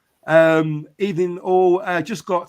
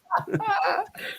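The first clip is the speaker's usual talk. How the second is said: real laugh, much higher; high burst of laughter; 5 dB quieter than usual. Real, not acted. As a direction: break into a squeaky laugh. Laughing at mum in chat.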